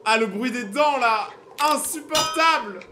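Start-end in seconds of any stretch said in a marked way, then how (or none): 1.6-2.8 drawn-out disgust. Real, not acted. Reacts to teeth noise.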